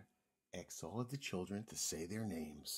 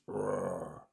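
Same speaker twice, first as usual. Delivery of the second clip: playful rumbling roar; dinosaur roar; 3 semitones lower, 4 dB louder than usual. A narrator's reading. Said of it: "roared his dinosaur voice".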